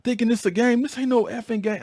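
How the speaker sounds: high-pitched, heated rant; going off. Retold or imitated. Angry sergeant going off at his boat crew, high-pitched rant. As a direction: retell yourself losing it, pitch shooting up. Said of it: "I'm just going off".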